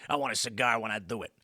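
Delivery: low mock tough guy; cigar-smoking bomber. Host quotes the bomber posing for Life magazine, low mock tough-guy voice.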